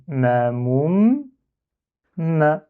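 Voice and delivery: "ma moum, ma" with a long held m; syllable reading. Shown as a demonstration. Teacher reading the word with shadda, drawn-out "ma moum, ma". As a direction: read "ma moum, ma" slowly, holding the m. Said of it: "Donc on lit".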